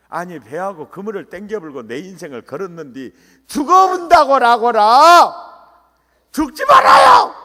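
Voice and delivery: Jeolla dialect building to a high, full-force shout; Jeolla-dialect protest. Acted. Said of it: "항변을 어떻게 했냐면은 전라도 버전으로". (전라도 사투리로 항변하다 높게 절규)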